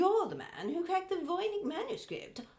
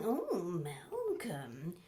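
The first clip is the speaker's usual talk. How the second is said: slow soft murmur of his name; low tender murmur; 8 semitones lower, 3 dB quieter, much slower than usual. Acted. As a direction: drop low and linger on it. Repeated, lower and softer.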